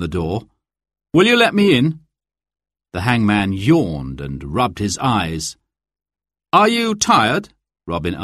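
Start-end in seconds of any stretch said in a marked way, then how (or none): 1.1-2.0 high, false polite voice; disguised voice. A narrator's reading. Robin disguised at door. 6.5-7.4 high, false polite voice; disguised voice. A narrator's reading. Robin to hangman.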